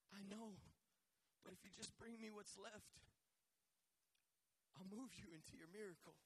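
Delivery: soft, quiet reassurance from God; gentle reply. Retold or imitated. Preacher voicing God softly answering the complainer.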